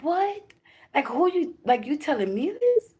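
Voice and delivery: high, startled disbelief; shocked disbelief. Retold or imitated. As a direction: squeak out the shock. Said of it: "that's how my mind was".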